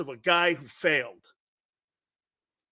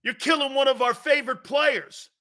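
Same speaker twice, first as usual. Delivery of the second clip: lightly voiced fan protest, pitched up; a hint of mock pleading, higher than usual; imitated complaining fans; 7 semitones higher than usual. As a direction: mimic a listener's objection in a higher voice. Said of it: "Some of you are going like this".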